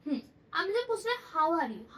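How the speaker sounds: ordinary talk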